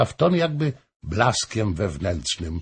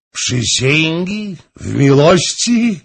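higher, slow amused question on Przysięgi; amused disbelief; 10 semitones higher, 7 dB louder, slower than usual. Acted. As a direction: let the king savour Przysięgi in smiling disbelief. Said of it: "Król się uśmiechnął". (król z uśmiechem, powoli i wyżej: Przysięgi?)

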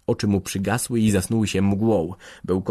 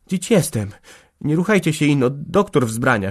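raised, anxious voice; understated fright in a higher pitch; frightened; 6 semitones higher, 5 dB louder than usual. A narrator's reading. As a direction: plead nervously with the sick man. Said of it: "szeptała zestrachana".

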